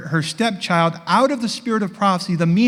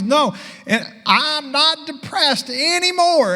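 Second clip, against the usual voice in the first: higher, slow voiced woman; happy patient; 11 semitones higher, slower than usual. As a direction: savour the good news in her voice. Patient tells of her recovery.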